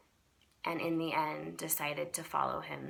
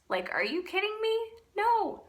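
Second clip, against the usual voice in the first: much higher, louder, fast and disbelieving; exasperated outburst; 5 dB louder than usual. Retold or imitated. Refusing God's call, a high exasperated outcry. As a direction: fling it out in full exasperation. Retelling arguing with God.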